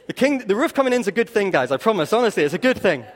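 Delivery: loud, emphatic preaching. Acted. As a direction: drive it home, insistent and loud. Preaching at full force.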